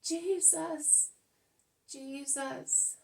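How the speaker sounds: frantic calling for help; desperate shout. A narrator's reading. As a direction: cry out the name loud and high, pleading. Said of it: "Eli does nothing but shout".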